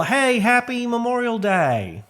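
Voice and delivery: mock casual greeting pitched high; breezy greeting. Mocking people who greet casually on a solemn day, high. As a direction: toss it off high and carefree. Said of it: "So it's just real".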